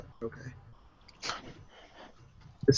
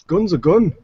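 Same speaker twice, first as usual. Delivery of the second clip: big-guy voice, pitched up and louder; loud, higher verdict from a big guy; 6 semitones higher, 6 dB louder than usual. Acted. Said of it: "the big guy kinda takes it".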